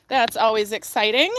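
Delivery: usual speaking voice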